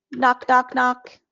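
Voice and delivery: playful toy voice; toy cow knocking. Shown as a demonstration. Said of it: "we can say with our cow".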